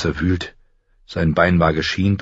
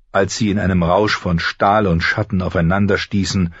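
plain reading voice

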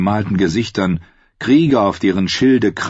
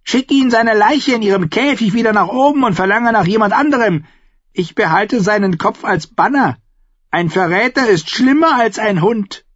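much higher, pressing wild woman's voice; a fierce raider woman urging the king; urging Harma; 4 dB louder than usual. Acted. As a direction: push hard for the kill in a high, strange voice. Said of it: "drängte Harmer".

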